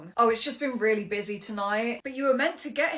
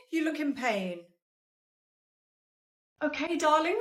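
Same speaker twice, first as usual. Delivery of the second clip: ordinary talk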